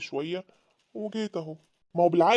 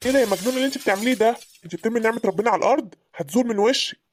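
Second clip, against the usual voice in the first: heated, higher-pitched outburst; angry scolding; 3 semitones higher, 4 dB louder than usual. Acted. Husband scolds wife for throwing food down, angry outburst. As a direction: scold her in shocked anger.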